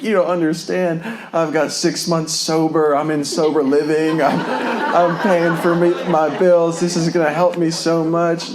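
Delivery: high, loud, tearful pleading; crying plea. Retold or imitated. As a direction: beg through tears, voice up high and strained. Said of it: "I cried like a little girl".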